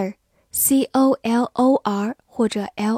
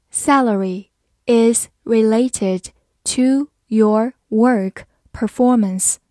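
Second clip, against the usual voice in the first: slow, careful word-by-word reading; 4 dB louder, slower than usual. Shown as a demonstration. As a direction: read it slowly for learners. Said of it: "好，慢慢来读。".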